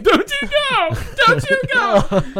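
high, wailing plea with a laugh; desperate pleading. Retold or imitated. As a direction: beg the dog not to go, half laughing. Pleading to dog during movie.

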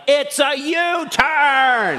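very high, slow, stretched-out holler; indignant mock yell. Driver yelling at honking pickup, indignant yell. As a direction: wail it in comic outrage.

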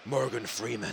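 low, soft film-narrator voice; Morgan Freeman. Acted. Host trying out the actor's name, low soft impression. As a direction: drop into a quiet actor impression. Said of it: "That guy sounds just like him".

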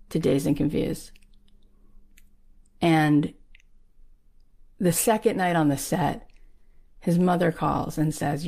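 ordinary talk

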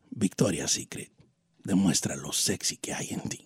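very low, hushed seductive announcer; sexy ad voice. Shown as a demonstration. Don Cheto taking his turn at Victoria's Secret, sexy ad voice. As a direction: murmur it deep and soft like a perfume spot. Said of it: "Ahora, la, la de Victoria's Secret".

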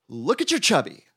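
loud, high excited cry; astonished high exclamation; excited alert. A narrator's reading. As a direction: call out high and urgent. Frog alerts Toad in story.

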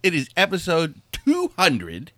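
showman welcoming the crowd; grand entrance announcement. Acted. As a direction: ringmaster welcome. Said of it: "host grand welcome".

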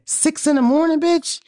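high, outraged squeal; incredulous rant. Real, not acted. Calling out her cousin for morning party music, with a high outraged squeal. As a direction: shriek it in disbelief.

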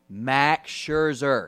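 slow, weighty reveal of Max Scherzer; dramatic reveal. Acted. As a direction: build it up like the big finale. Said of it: "when you think that list is already good enough".

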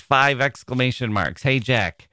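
usual speaking voice

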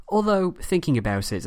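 ordinary delivery

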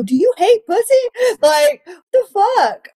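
high-pitched, exasperated disbelief; retold incredulous outburst. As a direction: squeal the question in mock outrage. Retells incredulous question to ex.

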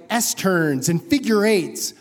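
ordinary delivery

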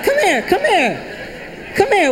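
high, insistent come here; mother summoning child; mom calling. Acted. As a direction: coax the kid over, rising up. Mom calling kid over.

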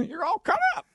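laughing, very high; squeaky voice. Pretend. Silly voice with a laugh.

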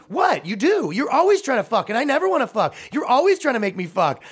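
put-on high, loud outraged girlfriend rant; indignant rant. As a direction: complain loudly to the whole room.

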